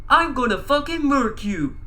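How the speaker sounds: high-pitched threatening yell; Cat in the Hat scream. A narrator's reading. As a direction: yell it as a cartoon villain's threat. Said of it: "the Cat in the Hat screamed".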